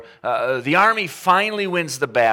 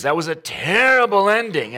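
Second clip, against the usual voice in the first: lightly voiced child's complaint, higher and louder; a hint of a pouting nine-year-old; nine-year-old daughter; 7 semitones higher, 6 dB louder than usual. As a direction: mimic an upset kid. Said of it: "She goes".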